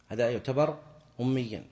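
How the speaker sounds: ordinary talk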